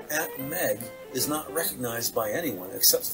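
normal talking voice

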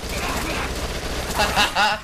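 amused chuckle at own nonsense; real laughing fit. Real, not acted.

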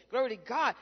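ordinary talk, nothing marked

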